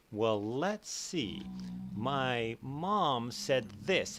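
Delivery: usual speaking voice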